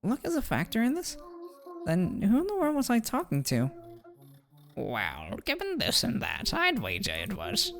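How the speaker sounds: pompous, musing lecturer with swooping pitch; swooping, measured know-it-all; wordy theorizing character. Acted. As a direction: muse aloud like a self-assured scholar, then instruct. Voices a game character.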